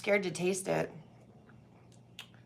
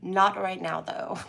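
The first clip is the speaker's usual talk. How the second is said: ordinary talk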